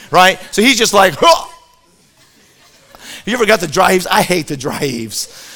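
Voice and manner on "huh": mock retch, sudden and high; imitated empty-stomach heave; dry heave. Sick kid with no food in him, imitated dry heave. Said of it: "He's just dry heaving".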